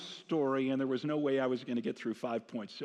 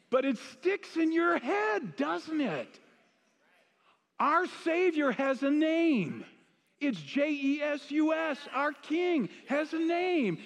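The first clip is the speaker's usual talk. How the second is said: high, drawn-out preaching cry; preacher driving a slogan home, high and emphatic; rousing proclamation; 11 semitones higher, 4 dB louder, slower than usual. Real, not acted. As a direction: proclaim it slowly, pitched way up and ringing out. Preacher proclaims his parody of the jingle.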